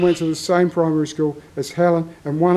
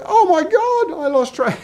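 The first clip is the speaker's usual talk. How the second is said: high, squealing burst of surprise on Oh my God; imitating his wife's shocked delight at meeting an old friend; amazed exclamation; 11 semitones higher than usual. Helen's amazed reaction, retold.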